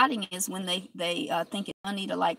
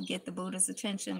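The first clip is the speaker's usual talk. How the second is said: normal talking voice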